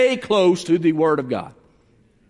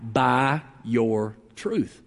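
"By your truth" very slow, lower and softer; drawn-out answer; 10 semitones lower, 5 dB quieter, much slower than usual. Shown as a demonstration. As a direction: lean into "truth" slowly and quietly. Preacher answers his own question.

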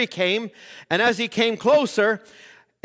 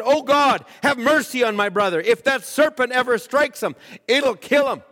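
desperate prayer; 3 semitones higher than usual. Retold or imitated. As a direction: plead aloud for the brother's life. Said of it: "I threw my hands up and I said".

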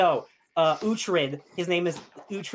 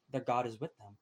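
grim hush; 4 semitones lower, 10 dB quieter than usual. Retold or imitated. Defeated lord answers.

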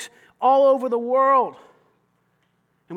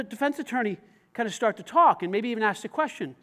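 normal talking voice

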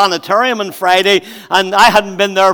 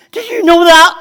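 surprised; 9 semitones higher, 8 dB louder than usual. Retold or imitated. Bride turns to fiancé, moved.

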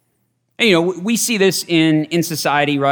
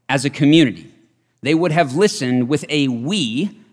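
ordinary delivery

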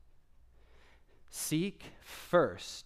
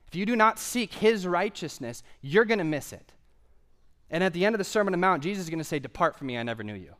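raised, driving pulpit exhortation; forceful preaching; 5 semitones higher, 5 dB louder than usual. Real, not acted. Preacher urging people to seek the kingdom, forceful exhortation. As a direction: build repeated commands into an insistent charge.

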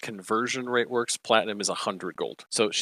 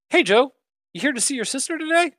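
much higher, brisk friendly greeter; chipper guy; 6 dB louder than usual. Acted. The guy greeting Joe, chipper and high. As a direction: chirp it up high and fast. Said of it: "the guy goes".

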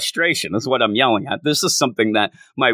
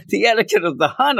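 mocking repetition; 9 semitones higher, faster than usual. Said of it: "I said".

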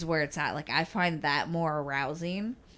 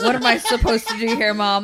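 laughing; 5 semitones higher, 9 dB louder than usual. Retold or imitated. Mock phone call to mom.

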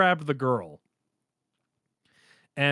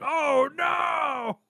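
high hammed-up panic cry; imitating a JoJo freak-out; mock freak out; 10 semitones higher than usual. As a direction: squeal it like a cartoon freak-out. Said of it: "old school style JoJo freak out where he's like".

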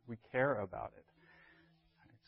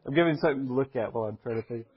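quick words through a laugh; laughing explanation; 4 dB louder, faster than usual. Real, not acted. Teacher excusing his hand waving to the class, laughing quickly. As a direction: explain it while still chuckling. Joking about his gestures.

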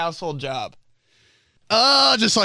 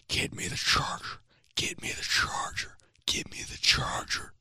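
whispered, soft chant of the same words; machine whisper; 9 dB quieter than usual. Retold or imitated. Washing machine seems to whisper the words, whispered. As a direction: hiss it like a washing machine murmuring. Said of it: "my washing machine right now is saying".

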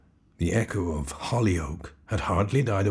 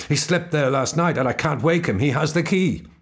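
frantic; 8 semitones higher, 8 dB louder than usual. Acted. Frantic pleading to officer.

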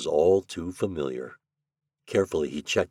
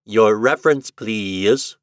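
a chirpy, metallic library automaton, louder; cold metallic machine voice; 3 semitones higher, 7 dB louder, slower than usual. A narrator's reading. Library automatic asks Shandor for his reference, cold and metallic. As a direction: speak like a cold machine clerk. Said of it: "cold metallic voice of the automatic chirped".

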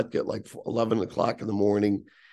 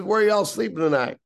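higher-pitched, lightly imitated jeering boss; mocking team owner; 8 semitones higher, 4 dB louder than usual. Team owner teasing the walker before the crowd, mocking. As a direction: put on a boss toying with a kid. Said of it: "everybody starts cackling, and he's laughing".

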